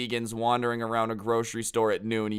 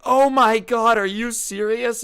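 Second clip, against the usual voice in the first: very high, loud imitation of shocked vegans; hammed-up scandalized outburst; mock outraged disbelief; 12 semitones higher, 9 dB louder than usual. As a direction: shriek it like an appalled protester. Vegans outraged at his trolling.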